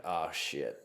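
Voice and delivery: soft, low dismayed curse; dismayed oh shit. Retold or imitated. Dad realizing he hurt his son, softly dismayed. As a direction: mutter it as it sinks in. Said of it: "just the way that I said it".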